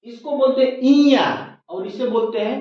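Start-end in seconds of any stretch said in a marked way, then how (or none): 0.8-1.3 इया said with a leading i, as iya; the wrong way: इया with an i before the ya; wrong pronunciation. Shown as a demonstration. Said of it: "अशुद्ध उच्चारित करते हैं".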